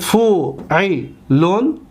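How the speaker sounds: فوعلون very slow, with gaps between syllables. Shown as a demonstration. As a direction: sound out فوعلون as fu, i, lun. Teacher repeats pattern by syllables.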